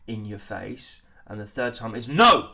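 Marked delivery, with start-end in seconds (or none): 2.1-2.5 loud, high commanding shout; direct order. Shown as a demonstration. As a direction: give the direct order, full force. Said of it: "you're giving a direct order".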